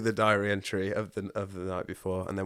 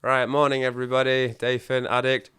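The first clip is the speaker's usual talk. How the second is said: group intro; 3 semitones higher, 6 dB louder than usual. Retold or imitated. Acting out morning meeting intro.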